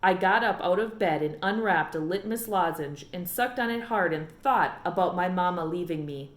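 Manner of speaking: normal talking voice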